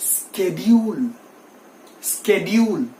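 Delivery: "schedule" as SKED-yool; professional schedule. Shown as a demonstration. Teacher models the professional pronunciation, sk onset. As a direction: open "schedule" on a hard sk. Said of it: "the right pronunciation for this word".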